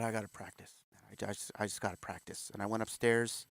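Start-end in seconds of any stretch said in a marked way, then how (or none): 0.0-2.5 soft, low, muttered to himself; teen in the basement telling himself quietly; quiet resolve. Retold or imitated. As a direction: say it under your breath, determined. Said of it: "and I said".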